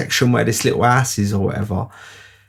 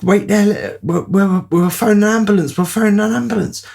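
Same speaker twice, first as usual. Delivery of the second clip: higher, fast, alarmed; urgent call; 9 semitones higher than usual. Retold or imitated. Storyteller tells his injured friend help is coming, urgent and fast. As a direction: blurt it out in a rush of panic.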